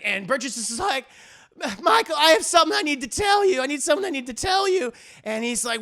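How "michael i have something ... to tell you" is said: high, rushed appeal; mock frantic urgency. Wife must tell husband something, hurried mock distress. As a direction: blurt it out fast, acting distraught.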